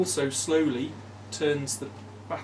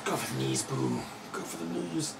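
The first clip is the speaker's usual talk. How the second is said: Minsk; 4 dB quieter than usual. Retold or imitated.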